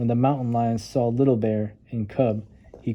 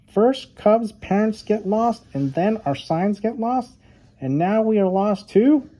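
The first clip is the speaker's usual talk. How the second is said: Duck, lost in the dark, complaining to her friends; unhappy Duck; 9 semitones higher than usual. Acted. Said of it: "Duck was not happy".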